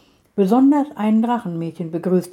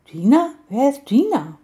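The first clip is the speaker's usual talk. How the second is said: surprised; 5 semitones higher than usual. A narrator's reading. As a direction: act taken aback. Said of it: "fragte Samira überrascht". (überrascht, höher und lauter)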